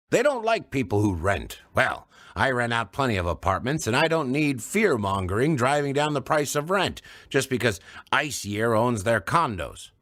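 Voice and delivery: ranting. Acted. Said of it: "had a rant bubbling up".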